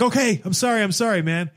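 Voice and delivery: high start, dropping into apology; retold exasperated apology. Swimmer keeps apologizing to yelling official, mock exasperated. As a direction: act out a harried repeated sorry.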